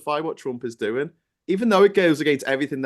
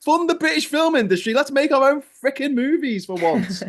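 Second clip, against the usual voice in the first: loud, high-pitched exasperated outburst; raised-voice comic indignation; exasperated rant; 12 semitones higher, 6 dB louder than usual. Retold or imitated. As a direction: plead loudly at the top of your range, fed up. Acted outburst over the film industry.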